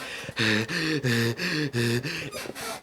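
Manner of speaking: imitated heavy, winded breaths; mock panting. Trainee gasping while sergeants yell at him, exaggerated panting. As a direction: pant like a runner who can't recover. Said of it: "Just trying to catch your fucking breath".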